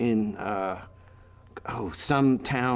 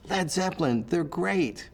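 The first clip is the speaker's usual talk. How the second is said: imitated young star; 7 semitones higher, slower than usual. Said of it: "She went".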